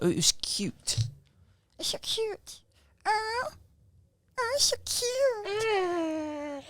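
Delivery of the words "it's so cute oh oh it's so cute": high whiny fake sob; hammed-up weepy; mock crying. Mock crying over cute song.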